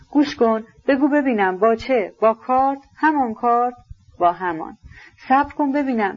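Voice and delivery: put-on a searching, uneasy young man; anxious prince. Prince pressing Rogozhin about the knife, anxious questioning.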